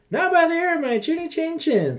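high squeaky piggy voice; squeaky little pig. Acted. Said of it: "said the second little pig".

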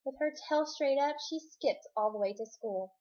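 ordinary delivery, nothing marked

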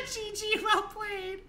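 very high, slow squeaky mimicry; drawn-out falsetto mockery; mocking quote. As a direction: mimic an opponent in a silly high voice. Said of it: "He said".

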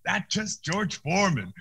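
high, slow, wobbly knocked-out mumble; hammed-up knocked-out boxer; mock dazed voice. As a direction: play punch-drunk after the tap. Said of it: "I went".

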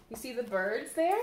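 ordinary delivery

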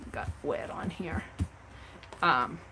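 ordinary talk, nothing marked

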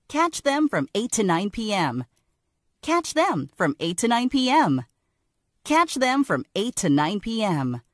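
punchy commercial tag; TV promo read. Shown as a demonstration. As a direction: sell the time slot. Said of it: "Like this".

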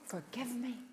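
whispered repentance. Retold or imitated. Humbled repentant prayer.

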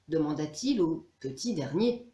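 ordinary delivery

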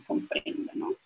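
normal talking voice

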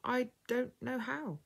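put-on slow, high little-boy voice; halting boyish admission, unhurried; slow, hesitant child. A narrator's reading. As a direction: draw each word out as an unsure small lad. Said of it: "Freddie spoke slowly".

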